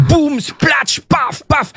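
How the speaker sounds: crash noises. Retold or imitated. Footballer smashing walls.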